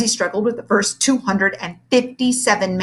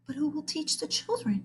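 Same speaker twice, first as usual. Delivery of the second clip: 1 shocked and faint; 9 semitones higher, 10 dB quieter than usual. A narrator's reading. Librarian shocked, pale.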